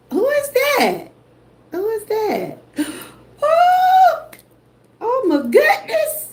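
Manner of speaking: high, tearful cry of surprise; emotional high-pitched shout of joy; surprised delight. Real, not acted. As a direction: gasp and cry out with real joy. Another surprise gift in chat.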